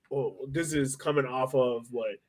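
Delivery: usual speaking voice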